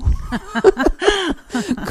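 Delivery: high, hearty burst of laughter; real, high-pitched laugh; amused laugh. Real, not acted. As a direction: laugh at being stumped by the question. Amused by the interviewer's question.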